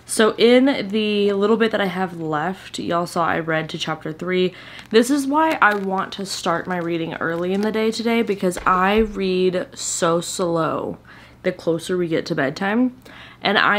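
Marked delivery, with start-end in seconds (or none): none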